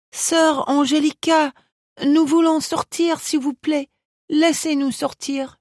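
put-on high child's pleading voice; a little girl begging in French. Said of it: "Marcia begged her".

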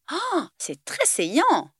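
slow, drawn-out, fighting a giggle; holding back laughter. A narrator's reading. Willow lies to Alex about his goatee, stifling laughter. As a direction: fake praise while stifling a laugh. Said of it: "mentit Willow en réprimant un fou rire".

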